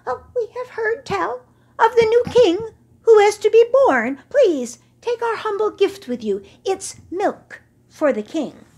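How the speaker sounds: high, loud, pleading goat voice; humble goat. Acted. Mountain goats offering milk to the camel, high and humble. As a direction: offer the gift meekly.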